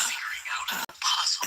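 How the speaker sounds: breathy secretive whisper; mock whisper. Mock Candace whispering about puzzles. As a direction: hush it like a secret. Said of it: "Candace is just like".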